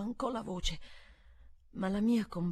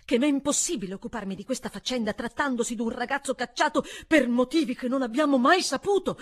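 higher, louder and fast, unbending; relentless tone; 6 semitones higher, 8 dB louder, faster than usual. A narrator's reading. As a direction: drive the point home hard. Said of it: "proseguì su un tono inesorabile".

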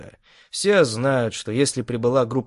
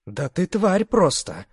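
hissing; 7 semitones higher, slower than usual. Acted. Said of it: "прошипела Фелиция".

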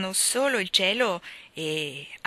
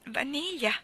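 put-on small, high little-girl voice; ashamed child; 7 semitones higher, slower than usual. A narrator's reading. Child admits which essence she used, ashamed. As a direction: answer sheepishly, pitched up. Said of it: "rispose la bambina, rossa di vergogna".

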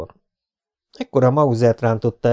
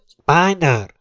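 drawn-out, raised-pitch call over a loudspeaker; loudspeaker hail; 6 semitones higher, 4 dB louder than usual. Acted. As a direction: call the name out like an amplified announcement. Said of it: "recsegte a szerkezet". (hangszóróból szóló felszólítás)

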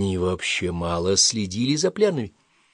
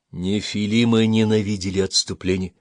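ordinary delivery, nothing marked

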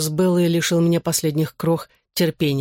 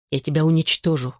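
cold, controlled threat; icy. A narrator's reading. Boy repeats his threat to his ex, icily. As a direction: state the threat with restrained coldness. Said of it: "с предельной холодностью повторил я".

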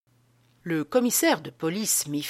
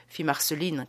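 plain reading voice

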